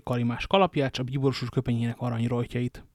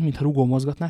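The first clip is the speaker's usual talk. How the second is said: ordinary delivery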